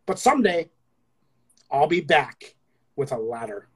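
slow, lingering love-poem recital with long pauses; sensual poem reading. Acted. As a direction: draw each image out seductively.

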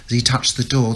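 ordinary talk

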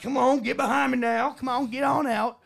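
hammed-up Southern good guy; Southern accent. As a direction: herd folks along in a Southern twang. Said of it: "but he's Southern".

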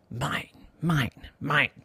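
soft, secretive mine; sly gloating. Acted. Boy hiding the ball in his pocket, quiet smug. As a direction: murmur it to yourself, smug and hushed.

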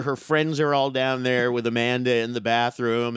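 ordinary delivery

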